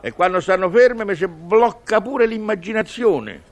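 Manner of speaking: normal talking voice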